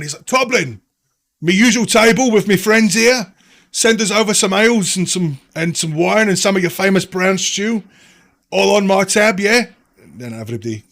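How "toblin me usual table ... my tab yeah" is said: higher, louder hearty call across the pub; innkeeper shouting over. Acted. Regular Holbrook calling to the barkeep, loud and cheery. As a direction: bellow it cheerily across a busy bar. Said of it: "he shouts over to the barman".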